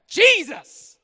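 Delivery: high, loud cry of "Jesus."; joyful shout. Shown as a demonstration. Preacher leading the crowd, shouting Jesus with joy. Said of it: "how about this?".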